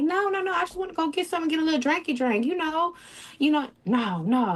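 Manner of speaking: mock sweet high-pitched begging; high pleading. Retells pleading with boyfriend.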